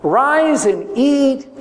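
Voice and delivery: high, slow pleading voice; a son coaxing his father, slow and high. Acted. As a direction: beg your old father to rise. Esau begs his blind father.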